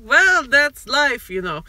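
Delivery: hammed-up movie star impression; Christoph Waltz. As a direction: draw it out slowly and project it. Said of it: "my flawless Christoph Waltz impression".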